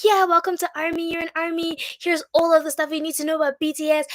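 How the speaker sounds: high, eager welcome, imitated; high-pitched, enthusiastic group-welcome voice; welcoming fandom. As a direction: imitate fans eagerly taking in a newcomer. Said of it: "Everybody was so welcome".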